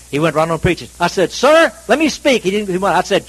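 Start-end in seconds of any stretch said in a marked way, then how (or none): 1.3-2.4 loud, high-pitched demand to be heard; insistent demand. Retold or imitated. Man cuts into nonstop preaching, loudly insisting. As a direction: raise your voice to cut in, retold.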